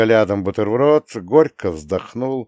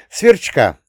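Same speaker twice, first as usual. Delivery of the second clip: put-on high, louder announcing boy; boy's revelation; 5 semitones higher, 6 dB louder than usual. A narrator's reading. Boy revealing the food of the future, high proud announcement. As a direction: announce it brightly, pitched up.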